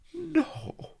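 breathy frightened whisper. Acted. Melliflua answering the captain in a scared whisper. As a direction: breathe it out in fear.

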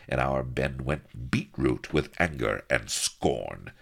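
usual speaking voice

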